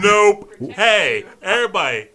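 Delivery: high, loud call to everyone. Retold or imitated. Calling the whole haunt crew to order, loud and high. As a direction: shout over the crowd.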